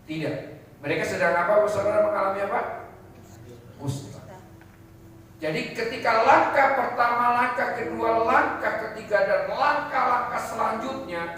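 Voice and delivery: high, loud, impassioned sermon voice; preacher building to a passionate peak; fiery preaching. Acted. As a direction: drive the point home to the congregation. Preaching builds to full force.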